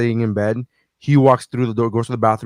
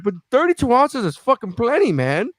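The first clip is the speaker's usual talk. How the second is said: indignant; 9 semitones higher than usual. Retold or imitated. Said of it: "And I'm like".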